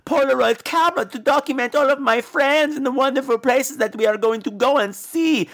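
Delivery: high, stilted dubbed-movie voice; put-on high complaining girl; badly dubbed heroine. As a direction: recite it like a bad English dub, every word evenly laid out. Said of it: "why are you talking like that".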